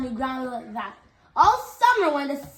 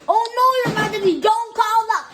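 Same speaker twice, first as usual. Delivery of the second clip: higher, louder, fast frightened plea; alarmed plea; 7 semitones higher, 6 dB louder than usual. A narrator's reading. Page boy begs the king not to call them, alarmed. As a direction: blurt it out in a panic.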